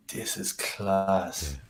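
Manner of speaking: slow, hushed whisper of wonder; awed whisper. Retold or imitated. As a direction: breathe it out slowly, amazed. Retold delight at new place.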